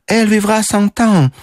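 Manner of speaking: high French exclamation; exasperated cry. A narrator's reading. Mademoiselle crying to the general as she leaves, high exasperation. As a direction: toss it out in French, pitched high. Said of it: "крикнула она, выходя из дверей".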